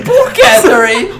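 squealing high through laughter; laughing. Real, not acted. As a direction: laugh it out loud and slow. Laughing disbelief at Catherine's fate.

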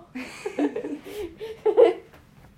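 giggling fit; laughing. Real, not acted. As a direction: keep laughing. Still laughing at the joke.

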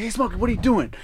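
high-pitched nosy question; imitated film character. Acts out film scene's crackhead.

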